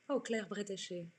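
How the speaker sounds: ordinary talk, nothing marked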